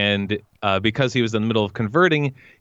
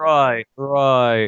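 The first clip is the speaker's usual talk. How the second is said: mock-British drawn-out word, pitched up; exaggerated British vowels; British accent; 4 semitones higher than usual. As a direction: imitate a musing British speaker. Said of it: "I love when British people say that".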